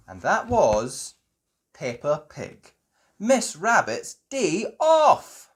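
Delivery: slow, drawn-out show sign-off; closing announcer. Acted. Narrator closing the episode, slow. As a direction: announce the title. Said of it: "show closing announcement".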